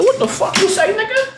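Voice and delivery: high, squeaky indignant comeback; mock outrage. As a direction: replay an offended challenge in a high voice. Acted comeback to a threat.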